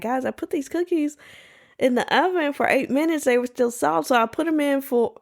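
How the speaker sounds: a hint of a bubbly influencer; imitating the girl. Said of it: "She was like".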